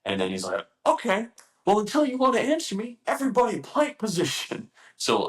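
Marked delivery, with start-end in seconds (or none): 0.8-4.7 high, hollered mock order; angry drill instructor impression; imitated instructor command. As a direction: bellow a punishment up high at the recruits. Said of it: "the big angry man".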